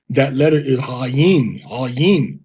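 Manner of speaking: normal talking voice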